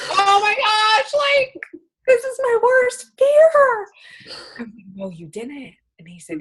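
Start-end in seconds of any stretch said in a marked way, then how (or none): 0.1-3.8 high, slow, strained cry of dismay; panicked. Retold or imitated. To the friend who unfriended her, a high slow panicked wail. 4.9-5.7 soft, hushed denial; quiet disbelief. Retold or imitated. To the friend at the bar, a soft stunned denial.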